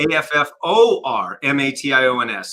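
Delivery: "A F F O R M A T I O N S" read letter by letter; spelled out. Shown as a demonstration. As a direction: spell "A F F O R M A T I O N S" one letter at a time. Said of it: "so it's affirmations".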